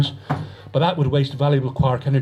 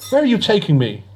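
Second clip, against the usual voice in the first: frightened call; 7 semitones higher than usual. A narrator's reading. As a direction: call out to the captors in alarm. Captive journalist calls out.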